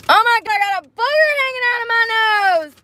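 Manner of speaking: high, startled cry of disbelief; shocked squeal. Real, not acted. Vlogger spotting a booger on camera, shocked squeal. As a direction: shriek at the sudden discovery.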